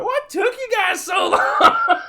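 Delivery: put-on high, slow, laughing drunk voice; drunk teasing. Greeting late friends at [name] show, laughing drunk. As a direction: stretch it out, giggling and tipsy.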